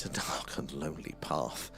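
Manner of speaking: low, soft and slow, mock sinister; mock spooky. Host to chat viewers, low slow mock-spooky murmur. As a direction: drop into a hammed-up spooky storyteller voice.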